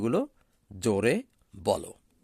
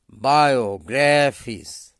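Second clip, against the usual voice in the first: Biographies slow, with the loud weight on গ্রা; stress on graph; 5 dB louder than usual. Shown as a demonstration. Teacher modelling the stress for students, slow and loud on গ্রা. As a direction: lean hard on the middle syllable গ্রা in biographies. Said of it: "গ্রাফের ওপর উচ্চারণ জোর হবে".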